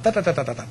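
"da" tapped with the tongue behind the teeth; tongue-tip syllables. Shown as a demonstration. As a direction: demo the tongue placement on "da". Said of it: "everything's done from inside the teeth".

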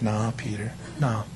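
Jesus quietly tells boastful Peter it won't happen, low and calm; calm firm Jesus. Acted. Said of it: "And Jesus said".

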